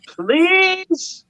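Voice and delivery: high, pleading little "please". Retold or imitated. Dad begging his daughter through the locked door, high and pleading. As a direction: beg sweetly through the door.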